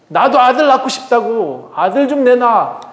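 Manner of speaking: high, acted whining insistence; nagging demand. Rachel wants a son too, high nagging demand. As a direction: plead and demand, pitched high. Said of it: "대판 싸우고 있어요".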